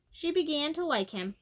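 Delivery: ordinary delivery, nothing marked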